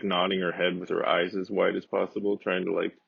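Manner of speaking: normal talking voice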